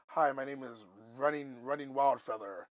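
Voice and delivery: lightly put-on solemn naming voice. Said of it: "It wasn't nothing like that".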